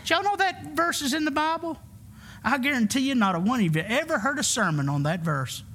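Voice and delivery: normal talking voice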